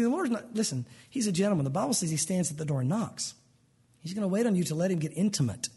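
ordinary delivery

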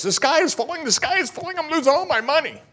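high, frantic mock panic; panicked squeal. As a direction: squeal it like someone losing everything. Panicked office worker on Wall Street.

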